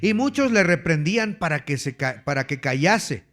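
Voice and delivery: ordinary talk, nothing marked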